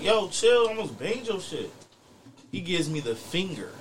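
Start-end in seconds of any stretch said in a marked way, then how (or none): 0.0-1.7 raised, higher mock yell that fades on the end; retold warning shout. Warning a scooter rider who nearly hit him, mock yell. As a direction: snap a warning at the rider, high then dropping. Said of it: "I'm like".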